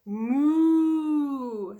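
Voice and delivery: long, loud imitated moo; cow moo. A narrator's reading. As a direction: draw out a storybook cow's moo. Cow noise in story.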